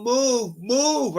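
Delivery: high, drawn-out holler; insistent high-pitched command; urgent retold yell. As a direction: re-enact hollering over a car horn. Honking and yelling, retold.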